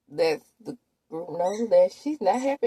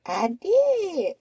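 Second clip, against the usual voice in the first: high mock retort; 7 dB louder, much slower than usual. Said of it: "says Qua".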